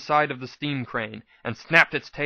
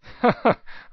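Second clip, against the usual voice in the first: short high burst of laughter; a quick relieved laugh; 9 semitones higher than usual. Acted. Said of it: "I said with a laugh".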